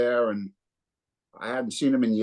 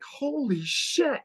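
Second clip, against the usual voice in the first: slow amazement; 11 semitones higher, much slower than usual. Retold or imitated. Hearing the singer for the first time.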